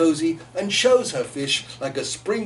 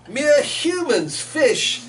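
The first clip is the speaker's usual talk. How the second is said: high, slow, louder sneer; sneering heron; 9 semitones higher, 3 dB louder, much slower than usual. Acted. Heron taunting the otter, slow high sneer. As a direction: look down your beak as you say it. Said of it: "he sneers".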